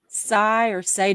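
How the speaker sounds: "Sai" rhyming with sigh, then "say"; two possible pronunciations. Shown as a demonstration. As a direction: slowly offer "Sai" or "say" as alternatives. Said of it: "which way it's supposed to be".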